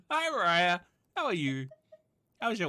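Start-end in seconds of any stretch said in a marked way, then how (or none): none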